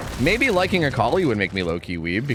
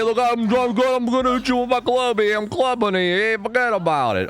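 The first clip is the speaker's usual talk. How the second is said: high, brash street-tough barks; mock tough-guy caller; 5 semitones higher, slower than usual. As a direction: shout the voice lines like a cocky wiseguy. Character voice-lines while playing.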